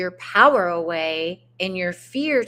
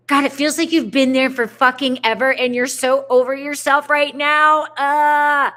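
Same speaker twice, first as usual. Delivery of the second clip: exasperated; 5 semitones higher, 6 dB louder than usual. Acted. Venting client's frustration.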